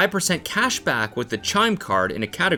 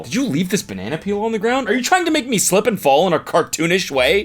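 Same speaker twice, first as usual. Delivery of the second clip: higher, indignant questioning; mock outrage; 6 semitones higher than usual. Friend replaying the cleaning lady's banana complaint, higher indignant. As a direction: whine it with fake offense. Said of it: "reenacting cleaning lady".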